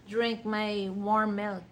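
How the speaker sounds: normal talking voice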